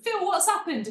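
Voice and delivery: a soap character calling out to Phil, bad EastEnders accent; mock EastEnders voice. EastEnders character asking Phil, high hammed-up EastEnders accent. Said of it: "I can't do an accent".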